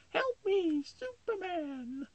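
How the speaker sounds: high falsetto wail, last word stretched; damsel in distress. Acted. Damsel interrupting the hero's day off, high mock cry.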